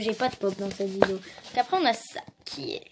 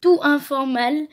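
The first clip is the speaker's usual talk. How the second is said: "Tout informal" said louder, fixing the first try in French accent; corrected reading; 3 semitones higher, 7 dB louder than usual. Real, not acted. Girl fixes her reading of the English card, louder. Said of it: "Vous allez voir mon accent de ouf".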